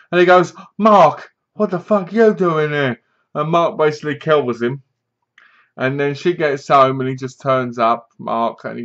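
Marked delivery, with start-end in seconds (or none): 0.8-3.0 raised, louder imitation of surprise; higher retold outburst; mock startled challenge. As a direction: act out finding an unwelcome visitor. Acting out boyfriend confronting the intruder.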